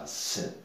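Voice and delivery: "S" as a voiceless sss; soft isolated hiss for the letter "S"; bare consonant sound. Shown as a demonstration. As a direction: sound out "S" alone, with no vowel after it. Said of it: "elle reste comme ça".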